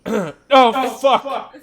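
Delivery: loud, high-pitched cry of dismay; alarmed outburst. Acted. Character hears of a zombie attack, loud alarmed outburst. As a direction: blurt it out loudly in alarm.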